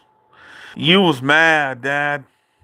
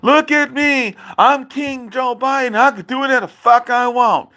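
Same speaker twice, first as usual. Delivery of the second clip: lampoon dad's king boast, pitched way up; mocking dad's boast; 8 semitones higher than usual. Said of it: "That's what he said".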